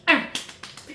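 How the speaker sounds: breathy, high approving mm; approving hum. Real, not acted. Approving hum after praise.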